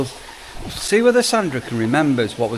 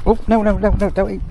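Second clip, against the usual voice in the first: alarmed; 8 semitones higher, 5 dB louder than usual. Real, not acted. Goat grabbing food from hand.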